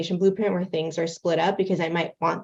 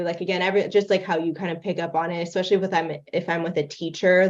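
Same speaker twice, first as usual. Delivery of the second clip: ordinary talk, nothing marked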